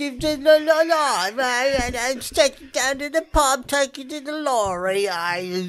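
mock British-Australian accent. Riffing on fake Australian accent.

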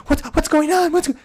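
loud, high-pitched frantic questioning; worked-up, much too loud and high; over-excited energy. Acted. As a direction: burst in loudly with pitch sky-high. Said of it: "gets the excitement and the, the energy up".

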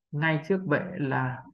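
ordinary talk

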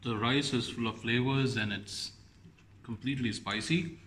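with food in mouth; chewing while talking. Real, not acted. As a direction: mouth partly full. Seen 0: Bite taken at -5; lips work as if chewing while he speaks.

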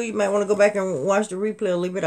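ordinary delivery

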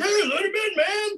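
high, stoked bro voice; cheering frat bro. Retold or imitated. Frat guys letting the Scottish guy in, stoked bro voice. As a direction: wave him in like a party bro.

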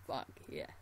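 usual speaking voice

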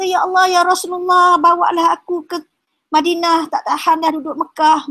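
high-pitched, imitated wailing plea; retold tearful pleading. Abu Jandal begs the Prophet to take him to Madinah, imitated tearful plea. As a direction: act out a crying man's plea to be taken along. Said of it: "menangis-nangis kata".

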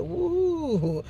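high drawn-out whoop; retold "woo". Exasperated exclamation at two babies.

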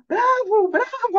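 high, loud imitated bravo shouts; enthusiastic cheering. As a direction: call out bravo like an impressed crowd. Technicians cheering after sound check.

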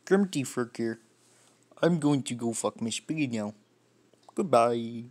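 put-on frog puppet voice; amateur puppet frog impression; Kermit the Frog. Shown as a demonstration. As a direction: sign off as a cartoon frog puppet. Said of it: "can't say anything else other than Kermit the Frog".

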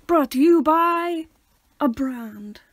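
high, loud, drawn-out sponsor intro; mock announcer. Host imagining a real sponsor, slow grand ad voice. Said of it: "We'd be like".

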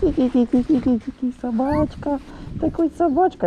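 high squeaky puppy imitation. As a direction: squeak it like a tiny dog.

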